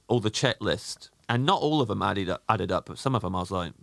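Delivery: ordinary talk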